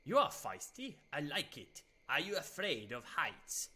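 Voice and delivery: put-on vampire voice, starting high and loud; hammed-up vampire. Streamer voicing Vlad after being punched, hammed-up vampire. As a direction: play a theatrical vampire intrigued by a feisty visitor.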